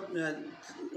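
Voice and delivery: ordinary talk, nothing marked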